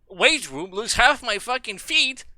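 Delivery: high, slow indignant question; shocked and offended; outraged disbelief. Real, not acted. Gamer shocked at losing his feet, high and slow.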